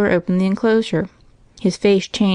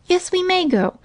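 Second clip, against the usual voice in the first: put-on very high little-girl voice; Minnie answering; 12 semitones higher than usual. A narrator's reading. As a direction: insist in a high child's pipe. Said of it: "answered Minnie".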